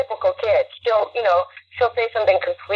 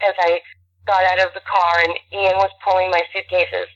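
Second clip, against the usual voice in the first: normal talking voice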